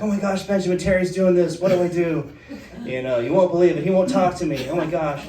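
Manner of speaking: high, loud, rushed panic; imitating a frantic friend on the phone; frantic Christy. Friend retells Christy's frantic calls.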